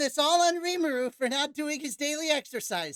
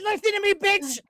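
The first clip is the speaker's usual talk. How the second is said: mock angry yell; 10 semitones higher, 4 dB louder than usual. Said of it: "I said".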